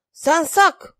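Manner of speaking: high, loud bellowed name; roaring call. A narrator's reading. Furious king summoning his guard, roaring. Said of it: "urlă regele".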